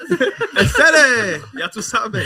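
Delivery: laughing Cuban Spanish slang; Cuban accent. Pretend. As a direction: drawl it Miami Cuban style. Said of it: "That's, like, super Cuban".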